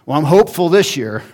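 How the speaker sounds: cheery fan's hopeful boast, tongue in cheek; mock hopeful fan. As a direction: play the eternally hopeful fan, chirpy and fast. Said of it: "go to your favorite sports team".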